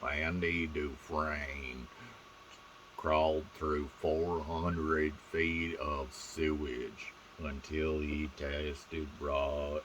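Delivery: put-on slow it right down like a wise old film narrator; Morgan Freeman impression. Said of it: "how the hell does a normal person do a Morgan Freeman impersonation".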